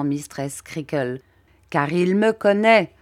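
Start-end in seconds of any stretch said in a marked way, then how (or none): 1.7-2.9 threatening. A narrator's reading. Creakle threatening.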